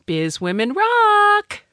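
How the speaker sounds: high, drawn-out cheer; cheering sign-off. Real, not acted. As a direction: call it out high and stretch the last word. Cheering the show name as sign-off.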